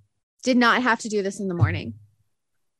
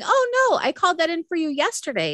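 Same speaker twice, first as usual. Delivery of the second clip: grandma's surprise; 7 semitones higher than usual. Retold or imitated. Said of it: "her going".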